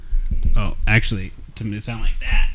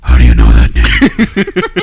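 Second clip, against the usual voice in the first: Batman growl; 12 semitones lower, 6 dB louder, slower than usual. Pretend. Batman Martha scene.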